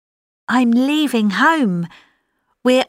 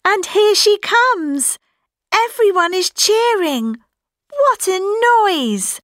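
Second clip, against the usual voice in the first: high, thrilled fan commentary; excited; 8 semitones higher than usual. Acted. Fan as the singer comes on stage, excited. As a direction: let the excitement leap up in pitch.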